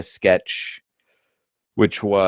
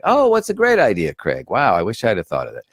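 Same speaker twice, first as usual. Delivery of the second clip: sarcastic praise. Retold or imitated. Performer hearing his own idea from the host, sarcastic. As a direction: gush as if you never had the idea.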